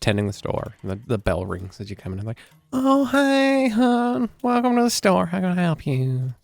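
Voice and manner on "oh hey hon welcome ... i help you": high, sugary shop sister; gushing friendly saleswoman; sweet shopkeeper voice. Acted. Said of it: "sisterly looking people".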